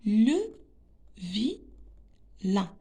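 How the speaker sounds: Le, pause, then vilain split as vi-lain; very slow teacher's reading of Le vilain; slow sounding-out. Shown as a demonstration. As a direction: break vilain into two widely spaced syllables. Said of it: "Je réexplique".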